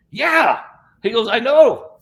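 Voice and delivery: Gary answering his healer about the arm, excited; excited reply. Retold or imitated. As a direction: answer with bursting joy.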